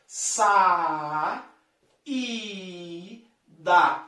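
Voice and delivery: sa, i, da as three separate beats; syllable by syllable. Shown as a demonstration. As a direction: pause after each syllable of saída. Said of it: "Como é que eu separo?".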